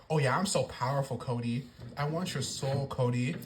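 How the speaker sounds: low, soft mocking Rock voice; mock creepy Rock. Vlogger mocks The Rock telling Cody he wants his soul. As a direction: purr it like a creepy villain. Imitating Rock's creepy promo.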